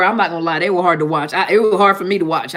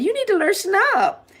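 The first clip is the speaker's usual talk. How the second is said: much higher made-up voice for a scolding friend; made-up Tasha voice; 12 semitones higher than usual. Retold or imitated. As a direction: squeak it way up like a nagging old friend. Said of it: "She don't really sound like that".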